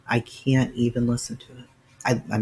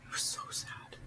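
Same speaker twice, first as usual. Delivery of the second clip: whispered, softer and softer; hushed whisper; 17 dB quieter than usual. Real, not acted. A streamer tells viewers how sad the recording was, in a sad whisper. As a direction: let it fall to a sorrowful whisper. Grieving over the sad audio.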